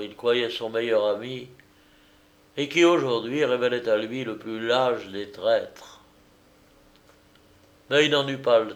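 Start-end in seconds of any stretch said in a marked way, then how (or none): none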